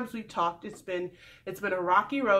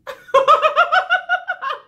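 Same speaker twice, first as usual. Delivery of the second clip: loud high laugh; 10 dB louder than usual. Real, not acted. Laughing at his answer.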